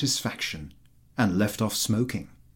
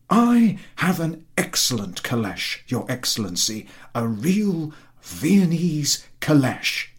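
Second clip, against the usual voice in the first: lightly voiced fawning landowner, higher; eager flatterer; 5 semitones higher, 4 dB louder than usual. A narrator's reading. Chertakutski flattering the general about his horse, eager and higher. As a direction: fawn over the horse in a raised, eager voice.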